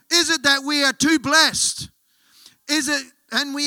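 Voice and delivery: loud, high, slow challenge to the church; impassioned preacher's rhetorical questions; forceful preaching. Real, not acted. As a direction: hammer each question out at the congregation.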